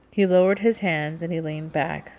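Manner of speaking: ordinary delivery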